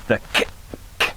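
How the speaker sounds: sharp k from tongue on roof of mouth; clipped "k, k" with the tongue; K sound. Shown as a demonstration. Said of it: "where the K sound is formed".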